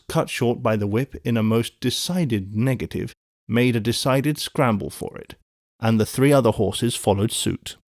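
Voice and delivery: ordinary delivery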